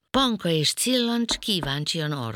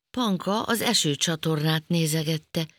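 usual speaking voice

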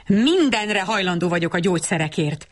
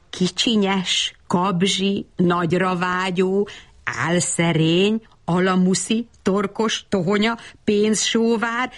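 ordinary delivery

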